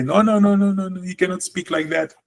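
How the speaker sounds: high, chiding "no, no, no"; scolding no no no. Retold or imitated. Teacher mocking an English speaker's reaction, chiding. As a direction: wag a finger at broken English in a high voice. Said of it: "In English, we say".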